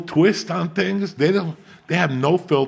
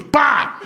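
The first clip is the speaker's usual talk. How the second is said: sudden high yelp of Pa; a friend imitating a climax cry; mock climax shout; 12 semitones higher, 4 dB louder than usual. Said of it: "he goes".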